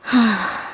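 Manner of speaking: a soft disappointed sigh; sad sigh. Acted. Student looking at her plant, quiet sad sigh. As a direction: sigh over the flower that never bloomed. Plant still hasn't bloomed.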